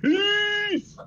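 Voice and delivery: high, loud burst of "Peace"; squeaky exclamation. Acted. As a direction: blurt it out high and bright. Mock code word.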